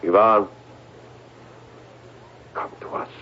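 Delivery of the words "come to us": faint, breathy whisper calling out; a trance-like whispered summoning; whispered summons. Acted. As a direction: whisper the call into the dark. Psychic summoning of Ivan.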